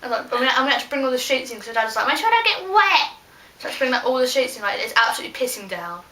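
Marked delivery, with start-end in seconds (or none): 2.1-3.1 high, raised-voice nagging dad; high-pitched imitation of dad; dad's order. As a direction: call it out high like a fussing dad. Voicing dad's instruction.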